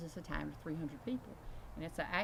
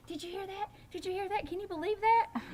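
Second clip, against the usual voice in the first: very high, squealed mimicry of amazed onlookers; amazed excitement; 9 dB louder than usual. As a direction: build to a loud, disbelieving peak. Retold amazed listeners at college.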